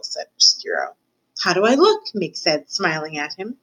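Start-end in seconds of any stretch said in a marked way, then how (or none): 1.4-2.0 put-on higher, hopeful girl's question; lightly voiced girl, pitched up; hopeful serving girl. A narrator's reading. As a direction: ask it as a naive girl seeking approval.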